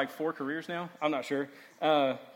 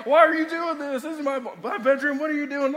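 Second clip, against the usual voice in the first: high loud demanding shout; dad yelling; 12 semitones higher, 11 dB louder than usual. Retold or imitated. Dad confronts son in his bedroom, loud high shout. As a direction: demand an answer like an outraged father. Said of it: "And he's like".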